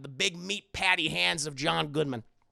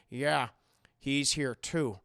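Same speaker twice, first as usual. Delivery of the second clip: lower, slow, soft captor voice; creepy captor Howard; 5 semitones lower, 4 dB quieter, slower than usual. Retold or imitated. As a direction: speak low and slow like a calm jailer. Howard voiced in retold plot.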